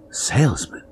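whispered, disbelieving question; incredulous whisper. A narrator's reading. Husband questioning his wife, whispered disbelief. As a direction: breathe it out in shock.

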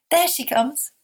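high, joyful call within a poem; glad shout. Acted. Voices greeting an arriving ship, high glad cry. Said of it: "other voices take up a glad shout".

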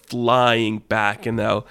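plain reading voice

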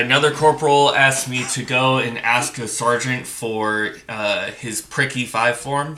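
usual speaking voice

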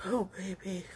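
very soft, spent endearment; quiet, drained tenderness; hushed murmur. Real, not acted. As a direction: let it out softly, almost to yourself. Alone, spent after emotional outburst.

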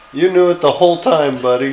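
half-scream it as a quoted catchphrase; imitated scream. Co-host quotes what the characters scream, mild mock yell. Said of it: "They also scream something".

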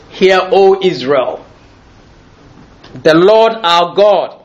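slow, measured reading of the great commandment; solemn scripture recitation. Acted. Preacher reciting the Shema to the congregation, slow and solemn. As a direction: intone each line like sacred text.

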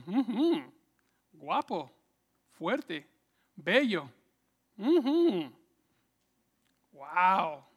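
ogle him, savouring each word; admiring seductress. Acted. Potifar's wife eyes José, hammed-up lustful admiration. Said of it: "La esposa de Potifar lo vio y dijo".

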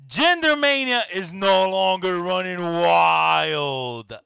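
loud slow booming ring call; wrestling announcer voice. Pretend.

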